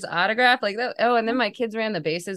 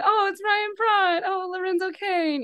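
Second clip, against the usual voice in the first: put-on higher, slow, wistful sighs over old players; 14 semitones higher, slower than usual. Fan missing old players, mock wistful. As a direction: mourn each name as it comes up. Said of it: "the whole video makes me kinda sad".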